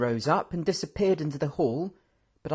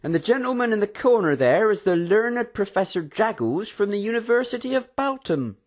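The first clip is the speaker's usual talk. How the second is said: lightly voiced host; 8 semitones higher, 4 dB louder than usual. A narrator's reading. Algovian introduces professor.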